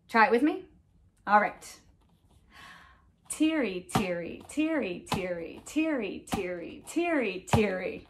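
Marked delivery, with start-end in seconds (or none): 3.3-8.0 even rhythmic chant of "tiri tiri"; steady beat. Shown as a demonstration. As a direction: keep each "tiri" falling evenly on the pulse. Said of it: "to our steady beat".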